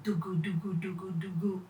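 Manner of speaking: low, quiet engine chant; soft engine hum. Acted.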